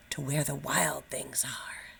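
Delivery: slow, breathless whisper; hushed slow whisper. A narrator's reading. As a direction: drop to a whisper. Whispered story climax.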